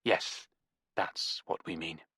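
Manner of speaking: icy curt retort. Acted. Nevada cold and curt.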